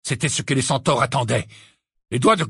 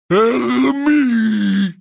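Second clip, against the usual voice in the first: loud, raised, drawn-out giant roar; Grope roaring; 7 semitones higher, 6 dB louder than usual. Acted. Giant roaring at Hermione for an answer, bellowing. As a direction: bellow the name like a huge creature. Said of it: "rugit Grope".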